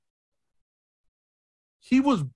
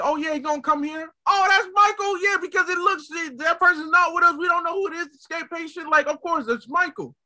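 high, excited mock outcry; excited crowd; 11 semitones higher than usual. People wrongly spotting Michael, high and excited. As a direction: jump in as the over-eager crowd, pitched way up. Acting out a panicked, mistaken crowd.

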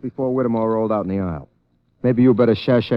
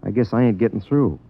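ordinary delivery

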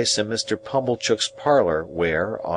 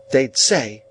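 higher-pitched, curt reply; brisk retort; 6 semitones higher, 4 dB louder than usual. A narrator's reading. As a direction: answer shortly. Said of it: "returned my sister curtly".

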